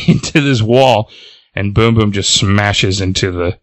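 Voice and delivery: ordinary talk, nothing marked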